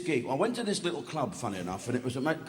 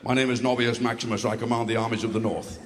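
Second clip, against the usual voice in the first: low, commanding film-hero voice; Russell Crowe; 8 semitones lower than usual. Acted. Mocking a film actor's army speech, low heroic impression. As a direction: announce yourself deep, like a Roman general. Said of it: "old Russell Crowe".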